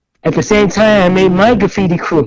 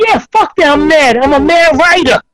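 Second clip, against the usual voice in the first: much higher, pumped-up declaration; defiant boast; 8 semitones higher than usual. Retold or imitated. Answering his friend, a proud high boast.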